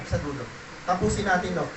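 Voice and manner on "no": usual speaking voice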